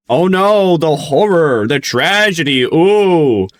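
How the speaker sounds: high, hammed-up wail of dismay; mock horror. Streamer ridiculing outrage over faked voices, mock horror. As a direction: swoop up loud in sarcastic horror.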